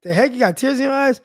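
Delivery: playfully imitating the wife spotting his tears; wife's surprise. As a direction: toss it off like a startled wife teasing him. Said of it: "She was like".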